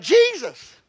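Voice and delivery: imitated high yell, drawn from a moment of panic; retold wife's startled yell. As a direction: cry the name out high. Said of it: "So she yelled out".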